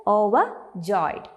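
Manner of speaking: "Overjoyed" slowly broken into parts; syllable by syllable. Shown as a demonstration. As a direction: say "Overjoyed" slowly as over, pause, joyed. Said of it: "How do you break down the pronunciation?".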